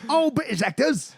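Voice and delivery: high mock British accent. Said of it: "that British in there".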